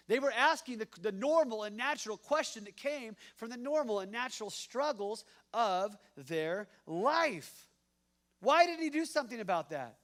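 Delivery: loud, high, impassioned preaching; preaching at full force. Acted. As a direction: drive the question home to the crowd.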